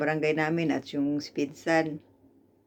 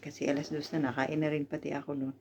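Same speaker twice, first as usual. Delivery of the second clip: ordinary talk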